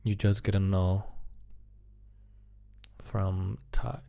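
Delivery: normal talking voice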